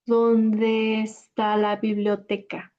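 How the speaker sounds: "¿Dónde está la biblioteca?" said slowly, syllable by syllable; careful for learners; slow teaching voice; slow, clear breakdown. Shown as a demonstration. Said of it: "We'll break it down afterwards".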